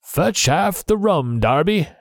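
the hidden voice repeats Flint's last words, slow and high; ghostly voice. A narrator's reading. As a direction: call out like a dead captain's ghost. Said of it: "the voice came again".

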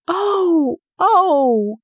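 high-pitched, thrilled gasp of oh; delighted cry. A narrator's reading. Colin hears Dickon is bringing his creatures, delighted cry. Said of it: "Colin cried out in delight".

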